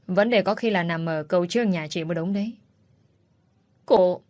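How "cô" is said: a sharp offended cry cut short; one word flung back at her; indignant outburst. Acted. Mother cuts off the rude visitor with an indignant cry.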